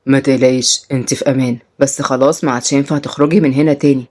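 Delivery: low, cool captor voice; controlling captor. Acted. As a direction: speak low to a captive. Salim addresses his captive.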